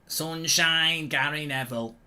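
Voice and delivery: put-on a touch of Manchester accent, drawn out; Gary Neville impression. A hint of a Manchester accent, requested by chat. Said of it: "Somebody just asked me to do it".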